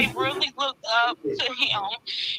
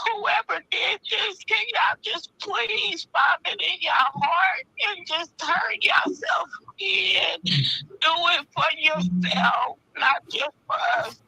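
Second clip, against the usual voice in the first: tearful plea; 4 semitones higher than usual. Real, not acted. Aunt begging the killer to turn himself in, crying. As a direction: beg them through tears.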